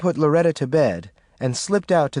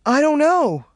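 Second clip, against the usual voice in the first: scared; 14 semitones higher, 4 dB louder than usual. Acted. Frightened mother answers child.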